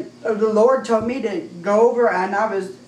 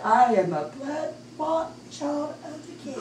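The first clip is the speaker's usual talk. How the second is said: soft, slow, higher-pitched mumble; imitated mumbling; 4 semitones higher, 5 dB quieter, slower than usual. Revived daughter to Sister Pat, imitated slow mumbling. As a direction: draw it out faintly, like someone just revived. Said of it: "Stacy was mumbling".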